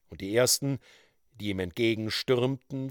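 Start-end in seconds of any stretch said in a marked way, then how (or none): none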